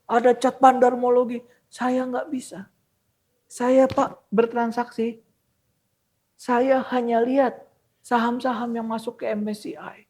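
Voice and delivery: put-on high old man's voice, halting phrases; elderly man's accent. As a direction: imitate an elderly trader explaining himself. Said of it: "dengan logat-logat udah tua gitu". (logat orang tua, nada tinggi)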